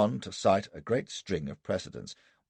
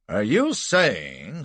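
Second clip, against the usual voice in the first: very slow, raised, loud query; incredulous question; 5 semitones higher, 6 dB louder, much slower than usual. A narrator's reading. Prosecutor challenges witness's new story, incredulous. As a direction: draw the words out in disbelief.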